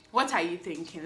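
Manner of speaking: ordinary talk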